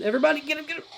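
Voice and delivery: high-pitched, excited command; urgent rallying call. Real, not acted. Player ordering everybody onto the enemy, urgent. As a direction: send everyone in at once, voice leaping up.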